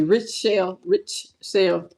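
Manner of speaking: "Richelle" as rih-SHELL, syllables set apart; corrected pronunciation. Shown as a demonstration. Streamer fixing a viewer's name, "Richelle" slowly as rih-SHELL. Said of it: "It's supposed to be".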